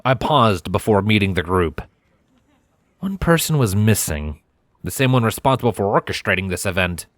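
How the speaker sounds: usual speaking voice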